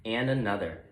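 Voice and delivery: usual speaking voice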